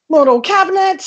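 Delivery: high, emphatic title reveal; game title announcer. Acted. Comic revealing another invented video game, game announcer voice. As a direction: call out the game's name with pitch lifted.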